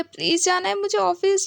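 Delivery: mock high-pitched plea; whiny pleading. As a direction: whine like a helpless captive. Begging to be put down.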